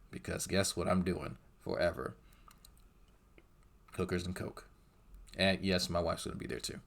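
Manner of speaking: low, soft, understated delivery; deadpan aside. Pretend. Streamer joking about his chances in hell, quiet deadpan. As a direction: drop down quiet and dry.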